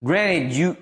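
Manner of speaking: ordinary talk, nothing marked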